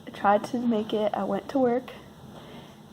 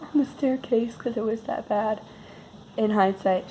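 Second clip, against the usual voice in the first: usual speaking voice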